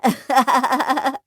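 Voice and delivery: hearty teasing chuckle; playful laugh. Acted. Brother-in-law joking with new bride, playful laughter. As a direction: laugh at your own revelation.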